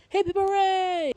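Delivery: high, slow chirpy cheer; lightly voiced happy little creature cheering; a hint of a bubbly mascot saying hooray; cheerful Box imitation. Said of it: "here's our first interaction with Box".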